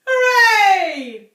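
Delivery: high, loud cheering shout; shout of joy. A narrator's reading. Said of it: "shouted Missus Cranky".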